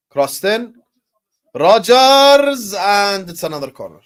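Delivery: excited commentator's call of an attack; mock football commentary. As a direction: pitch and volume surge on the player's name, then settle.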